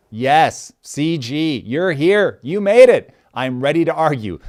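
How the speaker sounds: building to a high, loud cheer; excited welcome. Real, not acted. Host cheering a regular who showed up, excited. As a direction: greet them with rising delight.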